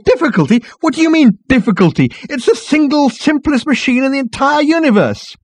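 very high, loud outburst; exasperated exclamation. A narrator's reading. Ford berates the marketing girl, exclaiming. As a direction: rant in disbelief. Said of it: "exclaimed Ford".

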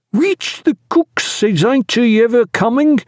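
high, drawn-out whine of a steward; complain, barely respectful, stretching the last words; shrewish complaint. A narrator's reading. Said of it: "his steward's shrewish, barely respectful, complaining voice".

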